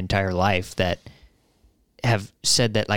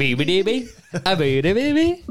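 higher playful trumpet noises, laughing into it; mock horn scat; 11 semitones higher than usual. Host joins friend's joke solo, high mock trumpet. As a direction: toot along like a silly cornet.

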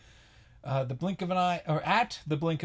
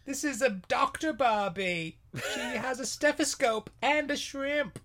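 high-pitched Aussie sales patter; cartoon Australian toy pitch; mock Australian accent; 7 semitones higher than usual. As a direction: offer it in a high, hammy Australian voice. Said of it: "And I'm Margot Robbie".

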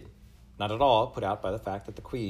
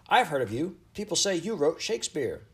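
high, eager gush; excited girl; 9 semitones higher, 5 dB louder than usual. A narrator's reading. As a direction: blurt it out in a thrilled, raised voice. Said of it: "jiggling up and down excitedly".

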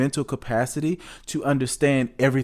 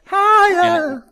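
sung high, louder cry; high call; 7 dB louder than usual. Pretend. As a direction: wail it upward. Hyping singer, cry heard.

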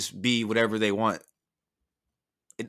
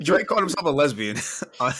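laughing; 4 semitones higher than usual. Real, not acted.

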